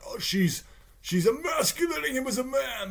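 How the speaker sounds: high-pitched put-on whine; mock indignant complaint; mocking complainer. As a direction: imitate an outraged whiner in a high voice. Mocks people who complain about her.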